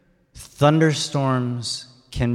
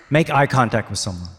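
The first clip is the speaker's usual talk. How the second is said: ordinary talk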